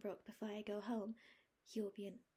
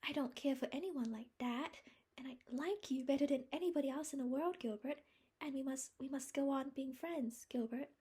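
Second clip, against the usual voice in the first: higher, faster, breathy pleading; earnest plea; 7 semitones higher, 5 dB louder than usual. A narrator's reading. Anne pleading with Gilbert to remain friends, earnest. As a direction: urge him to stay friends.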